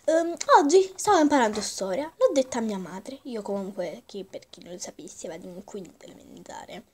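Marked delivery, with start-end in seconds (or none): 3.2-6.8 whispered aside; whispering. Real, not acted. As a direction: confide it under breath.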